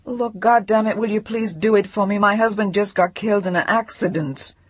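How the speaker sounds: frantic angry plea; desperate outburst. Acted. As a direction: force the operator to help.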